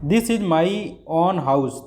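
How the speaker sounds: teacher demonstrating emphasis on own house; emphatic English demo. Shown as a demonstration. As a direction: show the stress on own. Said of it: "ऐसे हम दबाव डाल के देख लेते हैं".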